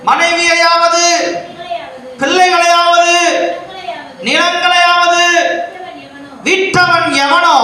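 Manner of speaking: high, drawn-out intoned reading; scripture recitation. Acted. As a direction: recite it slowly as a list, pausing after each item. Reads scripture aloud to congregation. (உயர்ந்த குரலில் நிறுத்தி நிதானமாக வேத வாசிப்பு)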